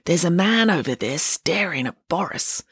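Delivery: plain reading voice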